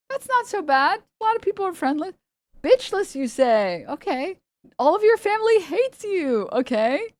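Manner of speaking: high, mocking faux-sympathetic voice; mock sympathy. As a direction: coo over them like a rare zoo specimen. Mocking hate commenters' profiles.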